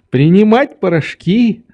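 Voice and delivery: "принимать порошки" high, rising at the end; agreeing old doctor. A narrator's reading. Doctor repeats Nelly's words.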